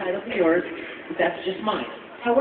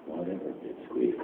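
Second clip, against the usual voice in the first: low and soft, dismissive; low, scornful brush-off; bored Ikaku sneer; 13 semitones lower, 9 dB quieter than usual. Pretend. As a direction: drop it low and let it slide off. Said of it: "Ikaku would probably just like".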